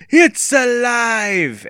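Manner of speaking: high, loud, drawn-out mock-horror cry; playful scream. A narrator's reading. Evan teasing Andy with the warm goo, playful scream. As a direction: scream it slowly in play, stretching the last word. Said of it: "Evan screamed playfully".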